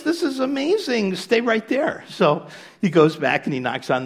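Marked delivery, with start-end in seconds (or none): 0.0-2.0 much higher, bursting with excitement; giddy joke-teller excitement; thrilled amazement. Retold or imitated. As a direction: gush like you've found treasure. Man thrilled by the dog.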